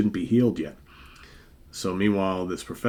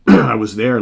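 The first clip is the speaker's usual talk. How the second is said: much louder, insistent; emphatic acted outburst; 3 semitones higher, 14 dB louder than usual. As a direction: burst out with it, insisting. Revived man insists to companion.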